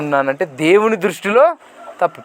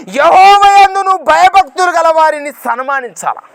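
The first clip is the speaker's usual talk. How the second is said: loud, high, ringing proclamation of scripture; preaching at full force; 10 semitones higher, 7 dB louder than usual. Acted. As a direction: cry the verse out to the congregation. (గట్టిగా ప్రసంగ స్వరం)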